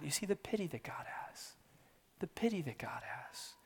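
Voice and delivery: hushed, breathy whisper; whispered pity of God. Real, not acted. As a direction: drop to a reverent whisper. Hushed over God's pity.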